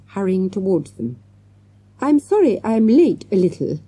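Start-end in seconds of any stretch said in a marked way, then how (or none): 2.0-3.8 raised, higher apology called out on the run; calling out. A narrator's reading. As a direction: call it out breathless from hurrying up the steps. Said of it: "Miss Parker cried".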